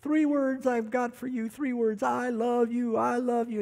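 high-pitched mock drunken call up to the window; lightly hammed drunk calling upstairs to his wife; drunk husband bellowing. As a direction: ham up a drunk pleading to be let in. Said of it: "he'd bellow upstairs to her window".

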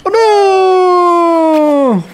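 loud cry of dismay; anguished shout. Real, not acted. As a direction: shout it in despair. Character caught in game.

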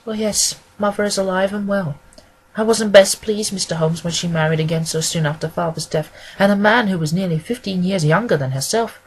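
rambling woman client. Acted. Woman giving the detective her inconsequential family history, rambling and earnest.

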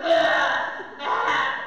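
shouted imitation baa; mock sheep cries; sheep bleat. Shown as a demonstration. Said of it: "we're like sheep".

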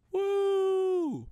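a high excited whoop. Acted. Hyping up strangers before the milking joke, high whoop. Hypes the chat.

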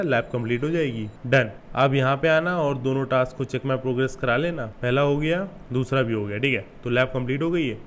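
ordinary talk

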